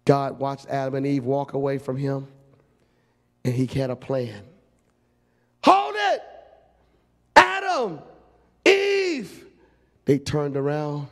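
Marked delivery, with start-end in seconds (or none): 5.6-9.5 very high, loud calling out; God shouting after the couple. Acted. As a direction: stop them in their tracks from afar. God calling them back.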